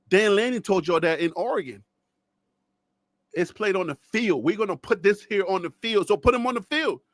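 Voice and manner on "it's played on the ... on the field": ordinary delivery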